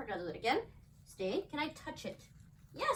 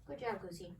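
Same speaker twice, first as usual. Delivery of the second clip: ordinary talk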